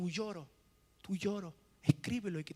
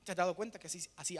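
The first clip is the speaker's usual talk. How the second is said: ordinary talk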